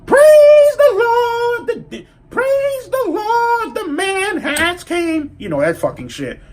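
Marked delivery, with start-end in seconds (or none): 0.0-5.4 very high, drawn-out mock preacher call; Baptist church preacher. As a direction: stretch it out like a hammed-up church praise. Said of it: "on some fucking Baptist church".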